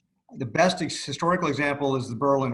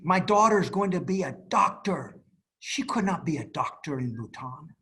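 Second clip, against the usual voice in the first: proud father voiced in a Bhutanese accent; driver's Bhutanese accent; 5 semitones higher, 3 dB louder than usual. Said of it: "he had an accent".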